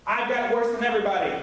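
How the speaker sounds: fast, mock-complaining whine; whiny self-pity. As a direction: hurry through a sulky complaint. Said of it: "is what the flesh says".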